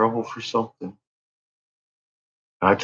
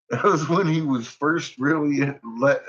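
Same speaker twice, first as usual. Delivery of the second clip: normal talking voice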